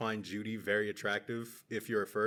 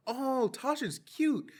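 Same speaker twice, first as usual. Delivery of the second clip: high, slow, drawn-out coo; cooing child; 13 semitones higher, much slower than usual. Retold or imitated. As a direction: gush sweetly like a smitten little kid. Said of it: "as a child, it was definitely more cute".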